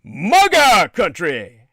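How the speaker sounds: loud, drawn-out mock cheer; imitated rallying shout, stretched out slowly. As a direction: holler it like a cheering heckler. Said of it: "two guys cheering".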